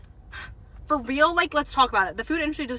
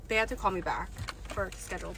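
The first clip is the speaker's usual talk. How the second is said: ordinary talk, nothing marked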